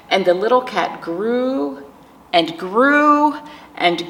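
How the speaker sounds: slow, gentle storybook reading with long pauses; reading to children. Acted. Teacher reading a picture book aloud to grieving children, slow and gentle. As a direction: let each page breathe for the little ones.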